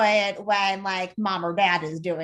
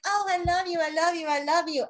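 doting parent; 8 semitones higher, faster than usual. Retold or imitated. Owner cooing at her pet, doting sugary voice. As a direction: coo it like a clingy parent.

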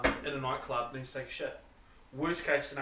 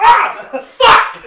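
sudden loud yelp of pain; pained yell; 22 dB louder than usual. Retold or imitated. Man hitting his head on the slide yells out loud. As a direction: cry out as your head smacks plastic. Headbutting slide top, yelling.

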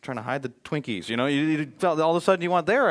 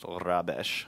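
low, mock-British snootiness; a hint of British disdain; snotty British; 8 semitones lower, 4 dB quieter, slower than usual. Shown as a demonstration. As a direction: put on a slightly snooty British accent. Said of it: "kinda goes British on this deal".

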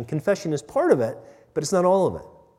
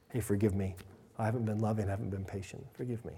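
very soft, low confession; quiet, contrite murmur; tender apology; 5 semitones lower, 12 dB quieter, faster than usual. Pretend. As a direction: humbly ask a spouse's forgiveness, hushed. Voicing apology to a spouse.

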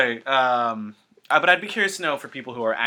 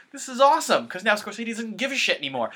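retold excitement; 8 semitones higher than usual. Telling a friend what he said after the film, retold giddy excitement. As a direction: replay your own giddy reaction at the movie's end. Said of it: "I said, it's like".

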